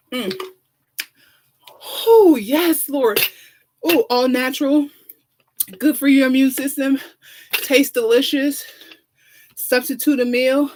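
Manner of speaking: a diva's hammed-up sponsor plug; campy talk-show ad read; sassy ad read. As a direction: sell the juice like a glamorous host. Sponsor plug for fruit juice.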